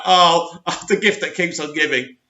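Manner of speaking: ordinary delivery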